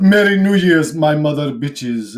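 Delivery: loud, weird put-on accent; Eddie Murphy. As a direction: belt it out in a silly fake accent. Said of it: "say it in a weird way with a weird, uh, you know, Eddie Murphy accent".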